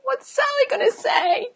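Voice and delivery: tiny high-pitched fretting voice; soft, squeaky mock worry; mock worrier. Coach mimicking a self-absorbed client worried about gossip, mock fretting. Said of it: "worried about Sally".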